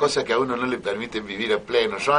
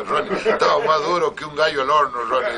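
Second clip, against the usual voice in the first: ordinary delivery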